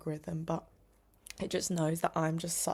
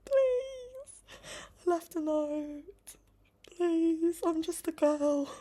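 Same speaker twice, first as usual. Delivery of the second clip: much higher, mock-tearful please; whiny plea; 10 semitones higher, much slower than usual. Real, not acted. Vlogger whining at the courier who keeps knocking. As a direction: beg in a little whimpering voice.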